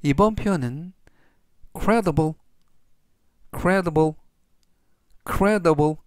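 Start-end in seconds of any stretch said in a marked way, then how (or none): 1.8-6.0 credible said clearly three times; teacher modelling credible; careful pronunciation demo. Shown as a demonstration. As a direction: model the word credible for learners. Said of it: "이라고 발음하시면 되겠고요".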